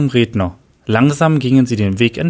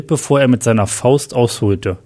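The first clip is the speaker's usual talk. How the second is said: usual speaking voice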